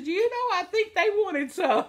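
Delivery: high, giggly retold line; retold reply, laughing. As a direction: giggle out the punchline.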